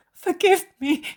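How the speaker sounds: high wailing cry of forgiveness; sobbing harder. Acted. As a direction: let the sob break wide open.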